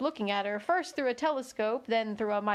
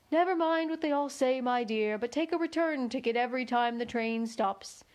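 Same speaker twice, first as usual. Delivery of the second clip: ordinary delivery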